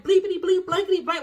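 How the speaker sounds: high, loud bleeped-out tirade; playful stand-in for angry swearing; mock cussing. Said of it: "when I get mad".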